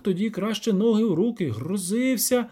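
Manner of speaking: lightly voiced peasant, high and lively; villager telling troubles. Villager complaining of the devil, lightly acted peasant voice.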